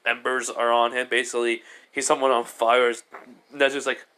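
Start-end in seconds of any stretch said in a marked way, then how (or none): none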